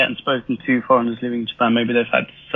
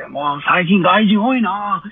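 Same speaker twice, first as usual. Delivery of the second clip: higher, drawn-out imitation of a grumbling shout; half-shouted mock complaint, pitched up; mock old-man shouting; 7 semitones higher, slower than usual. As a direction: imitate an old man calling out in Japanese, slowly. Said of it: "this old guy just shouting".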